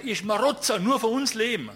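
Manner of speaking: loud, high yell with a laugh in it; angry insult. Retold or imitated. Angry local insulting the photographer, loud and high.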